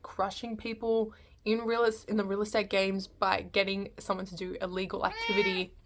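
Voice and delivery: ordinary talk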